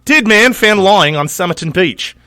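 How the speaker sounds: Australian accent, loud and over the top; bad Australian accent. Shown as a demonstration. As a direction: shout the headline like a bad Australian newsreader. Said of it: "my shittiest Australian accent".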